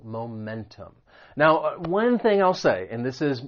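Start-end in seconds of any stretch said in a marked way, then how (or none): 0.0-0.9 momentum with the stress on MEN; stress on second syllable. Shown as a demonstration. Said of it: "The stress is on that second syllable".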